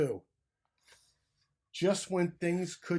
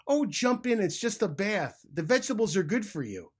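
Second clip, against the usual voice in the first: coaxing, higher and louder sly fox voice; 7 semitones higher, 7 dB louder than usual. A narrator's reading. Sly fox coaxes the turtles with a high, loud sweet-talking voice. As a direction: sweet-talk them into the pot. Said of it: "a sly fox".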